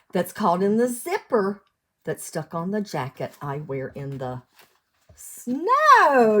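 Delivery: sing-song storybook read; lilting picture-book reading; reading to children. Acted. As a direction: read the rhyme out to little ones. Cumulative rhyme read aloud.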